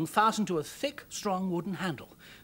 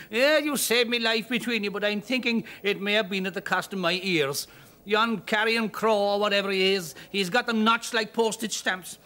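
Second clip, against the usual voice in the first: grateful Irish brogue, higher and louder; Irish man; 4 semitones higher, 5 dB louder than usual. Acted. Rescued Irishman thanking father and daughter, grateful brogue. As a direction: thank them in a thick Irish lilt.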